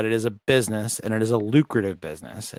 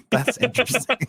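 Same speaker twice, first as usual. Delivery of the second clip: laughing; 13 semitones higher than usual. Real, not acted. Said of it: "That's all you need to say".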